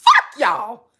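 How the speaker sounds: hammed-up sputter that bursts into a yell; stuttering cuss-out. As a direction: stammer up to the curse and slam it. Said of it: "I said".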